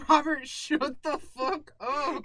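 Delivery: slow it down and scold him; slow stern scolding. Retold or imitated. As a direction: friend snapping at Robert, slow and loud. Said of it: "Crystal's like".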